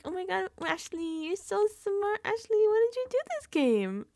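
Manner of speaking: high, fawning gush; jury gushing over Ashley in a high voice; gushing juror voice. Retold or imitated. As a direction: fawn over her like a fan. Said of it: "And Ashley's like".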